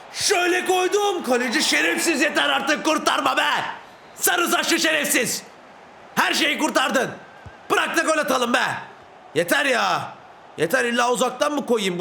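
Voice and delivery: sustained high-pitched angry rant; exasperated yelling. Real, not acted. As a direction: rage at the keeper who saves everything. Keeper keeps saving his shots. (kaleciye öfkeyle bağırarak)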